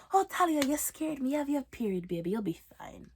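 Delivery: soft, calm soothing mother; calm her down gently; soothing mom. Retold or imitated. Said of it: "my mom's all like".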